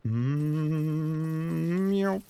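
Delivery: long high hum rising into a "myow"; wind-up noise. Pretend. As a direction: hum steadily upward, then flick it open. Imitated noise winding up an attack.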